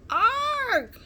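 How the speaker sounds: very high loud cry; falling yell. Acted. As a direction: yell while tumbling into a hole. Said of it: "cried Sid".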